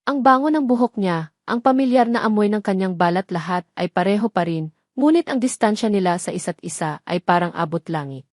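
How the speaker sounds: ordinary delivery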